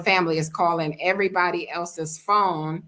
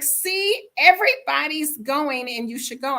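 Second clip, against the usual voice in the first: put-on girl's coaxing voice; 7 semitones higher than usual. Girl urges speaker to clubs.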